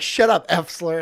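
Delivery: imitated snap. Streamer imitating Chevy insulting him, mock snap. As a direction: snap it like a grumpy old man. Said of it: "He's like".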